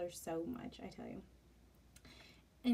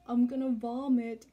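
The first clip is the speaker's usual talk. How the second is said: put-on drunk groan; 4 semitones higher, 12 dB louder than usual. Drunk husband about to throw up.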